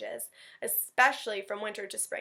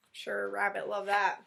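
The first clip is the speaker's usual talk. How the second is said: ordinary delivery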